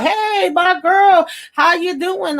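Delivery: very high, drawn-out hello; hammed-up singsong delight, stretched slow; mock gushing greeting. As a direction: imitate an overfriendly man greeting her. Acting out an overfriendly greeting.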